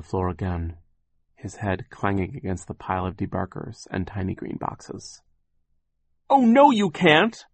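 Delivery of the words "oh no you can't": very high, loud, slow protest; desperate cry. Acted. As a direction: plead and shout at once. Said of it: "Violet cried".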